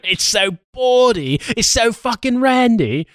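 high, slow, put-on prim voice; mock prim theatregoer. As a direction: feign scandalised delight.

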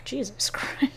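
whispered. Real, not acted. Reacting to choking friend.

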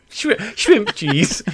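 retry shrimp cheese slowly, still giggling; slow giggling second attempt; laughing retry. Shown as a demonstration. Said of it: "This is sounding like the Swedish chef".

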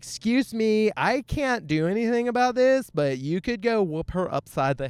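high-pitched, put-on helpless plea; mock appeal. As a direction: pitch it up as a play-acted request. Imagined appeal to girlfriend.

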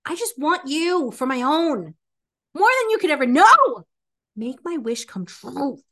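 normal talking voice

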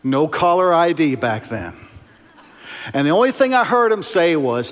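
normal talking voice

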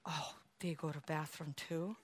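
low, very soft mock discovery; hushed realization. Member discovering leaders are only human, hushed mock realization. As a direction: murmur it like a scandalous secret dawning on you.

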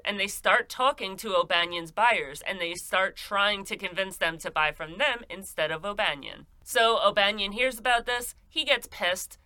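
ordinary talk, nothing marked

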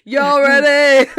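loud, drawn-out hype-rapper shout. Acted. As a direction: holler it slowly to fire up a crowd. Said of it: "I just turn into [name]".

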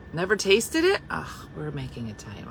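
normal talking voice

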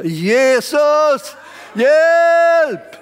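loud high cry for help, rising. Retold or imitated. Preacher acting out the believer's cry, loud rising call for help. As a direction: call out to Jesus as if desperate. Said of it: "som vi ropar".

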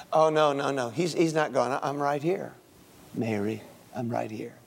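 soft, low reassurance; gentle Jesus. Acted. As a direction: quietly comfort her. Jesus comforting Mary.

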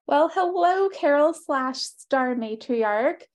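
ordinary talk

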